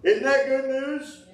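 high, slow, drawn-out good news; high and slow, beaming at the congregation; excited question. Real, not acted. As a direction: lift it up and savour it. Preacher asks congregation.

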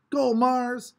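high, loud cheer from the crowd; a kid in the crowd cheers Mars on, loud and high. A narrator's reading. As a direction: yell for your runner. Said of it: "someone else called".

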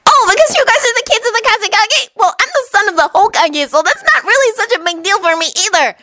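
high-pitched, eager explanation; chatty, guileless Naruto. Acted. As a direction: explain it brightly in a high boyish voice.

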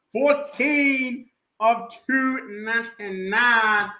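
loud whooping start, settling down; stretched numbers, punchy names; hype announcer. Acted. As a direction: call out the names like an MC.

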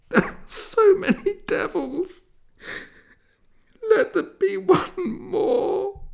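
very high, loud anguished wail breaking into sobs; crying out in anguish. Acted. Taibele prays to God for her dying devil, sobbing high wail. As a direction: cry it to the heavens, voice cracking with tears, slowing at the end. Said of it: "She cried out in anguish".

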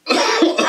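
sharp loud cough; hacking cough from the spice; real coughing from pepper heat; coughing fit. Real, not acted. Coughing after hot pepper.

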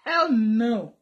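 low-key, firm refusal; firm denial. Real, not acted. Vlogger denying she misses her ex, firm and contained. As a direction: refuse firmly without raising the voice. Denies missing David, first take.

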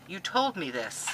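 normal talking voice

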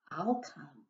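barely voiced, very soft; soft whisper. A narrator's reading. Anemone answering the crab, whispered softly. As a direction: breathe it like a shy anemone.

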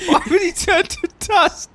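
high, slow, helpless laughing question; incredulous laughing. Real, not acted. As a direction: squeal the question through real laughter. Incredulous listener laughing at the ending.

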